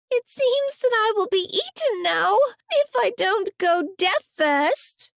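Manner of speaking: put-on high, fearful child's voice; a small girl expecting to be eaten; frightened little girl. Little girl with covered ears, scared of the beast.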